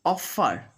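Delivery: "off-fer" with friction; clear middle F. Shown as a demonstration. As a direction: OFFER with a true F in the middle. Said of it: "when F is there in the middle".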